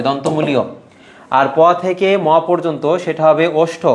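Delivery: usual speaking voice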